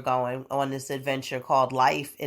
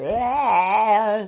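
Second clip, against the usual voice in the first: tired wail; 4 semitones higher, 5 dB louder than usual. Real, not acted. Tired groan after oohs.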